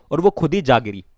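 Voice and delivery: ordinary delivery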